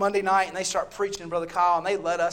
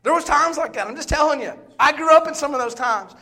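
loud, high, full-force preaching to the church; 7 semitones higher, 10 dB louder than usual. Acted. Preacher rousing his congregation, full-force preaching. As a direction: drive it home like a revival preacher.

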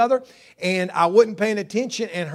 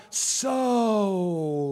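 "so" held very long, sliding slowly down; stretched-out SO. Shown as a demonstration. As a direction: draw out "so" as if written with a bunch of O's. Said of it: "you've gotta accent that word".

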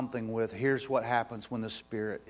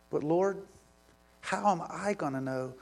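normal talking voice

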